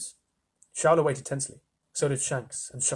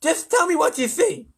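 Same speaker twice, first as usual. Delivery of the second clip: loud, high, impatient lisp; testy lisping leader; lisping President; 6 dB louder than usual. A narrator's reading. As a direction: demand a report with a lisp. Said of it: "I'm reading with a lisp now".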